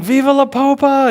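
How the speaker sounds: high, loud frenzied cheer; imitated crowd yelling. Pilgrims cheering as the Pope nears, imitated high yell. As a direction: cry it out like an ecstatic crowd. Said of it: "And they're yelling".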